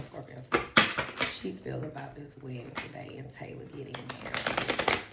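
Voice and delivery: fast whispered murmur to the spirit; whispered invocation. Real, not acted. Tarot reader asking the spirit, hushed whisper. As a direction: whisper the question into the deck.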